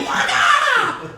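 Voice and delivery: high, loud laughing scream; mock scream. A henchman shrieking while Boba Fett blasts him off. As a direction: yelp it as you're flung away.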